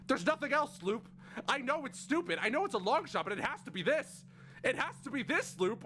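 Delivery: high, pressing, frantic questions. Acted. Siffrin begging Loop for answers, frantic and high. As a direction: pile the questions on, desperate.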